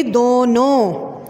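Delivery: दोनों drawn slow with a nasal ओं; दोनों, slow with clear nasalised vowels; nasal noon ghunna. Shown as a demonstration. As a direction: stretch दोनों and let the ओं ring through the nose. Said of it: "नून गुन्ना नहीं लगाएंगे".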